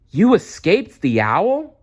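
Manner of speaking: high, loud, slow amazed cry; joyful cry. Acted. Stella Luna to her mother, joyful high cry. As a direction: burst out with delight. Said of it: "cried Stella Luna".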